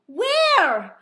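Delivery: high, loud where; shocked question; surprised where. Retold or imitated. Guide astonished Luis is there. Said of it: "She goes".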